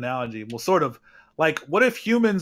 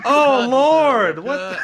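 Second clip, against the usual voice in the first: much higher and louder, startled; shocked outburst; 10 semitones higher, 8 dB louder, much slower than usual. Real, not acted. Reacting to a gross clip, loud shocked cry. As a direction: blurt it out in shock.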